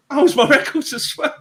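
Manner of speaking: high, laughing retelling of a friend's delight; a light take on a happy friend finding his stuff; friend's discovery. Retold or imitated. Friend finds his coat and records, lightly voiced high and laughing. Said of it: "and he went".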